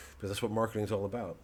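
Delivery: normal talking voice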